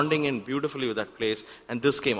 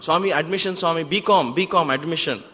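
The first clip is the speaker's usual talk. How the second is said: loud higher yell of a plea; 6 semitones higher, 6 dB louder than usual. Retold or imitated. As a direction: yell your plea across the crowd. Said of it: "literally I yelled to Swami".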